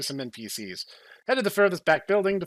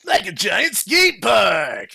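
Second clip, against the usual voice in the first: louder, slow gleeful shout; punk shout; 5 semitones higher, 7 dB louder, much slower than usual. Acted. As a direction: yell it like a rowdy skater. Punk shouting about taking over.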